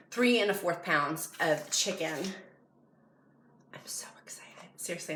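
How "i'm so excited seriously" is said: breathless whisper of excitement; excited whisper. Real, not acted. Vlogger whispers her glee to the camera. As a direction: whisper it like a secret thrill.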